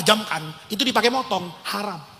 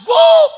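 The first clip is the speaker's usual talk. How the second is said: very high, loud imitated woof; shouted dog bark; 8 dB louder than usual. Dog reporting back after the kill, imitated shouted bark. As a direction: yell out one big mock bark. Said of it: "Teriak lagi dia".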